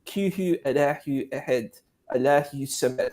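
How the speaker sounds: Kihu Allahu Ehed, slow, Turkish vowels; Turkish-style recitation. Shown as a demonstration. Showing friends a Turkish Quran pronunciation. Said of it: "there's a way of pronouncing it like that".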